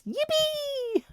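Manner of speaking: high, drawn-out squeal of delight; squeaky celebratory whoop; mock joyful yippee. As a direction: cheer it in a long high note. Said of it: "open the fridge and it's".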